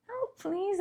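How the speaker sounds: put-on small, high-pitched cute child echo; adorable little-girl voice. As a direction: imitate a toddler, tiny and high. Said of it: "in her little adorable voice".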